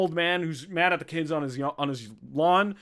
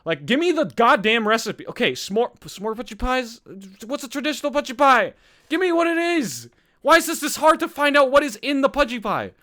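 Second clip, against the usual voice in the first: high, loud exasperated rant; worked up; 6 semitones higher, 5 dB louder than usual. Real, not acted. Host ranting at search results that hide the recipe, worked up. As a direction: demand an answer, pitch climbing with frustration. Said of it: "I'm getting so worked up".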